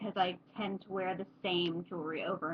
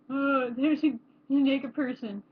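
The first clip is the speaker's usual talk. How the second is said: higher, slow mock shock; mock scandalized; 4 semitones higher, 5 dB louder, slower than usual. Imagined student seeing a nude model, mock scandalized. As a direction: gasp it like a scandalized onlooker.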